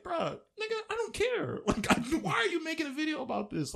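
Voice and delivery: very high-pitched, incredulous protest; exasperated outburst. Acted. Reacting to a bullied person's video, high-pitched exasperated outburst. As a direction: let the voice leap high in disbelief.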